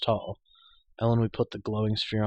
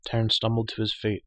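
ordinary delivery

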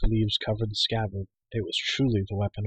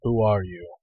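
demanding. A narrator's reading. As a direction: question a stranger with authority. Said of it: "he demanded".

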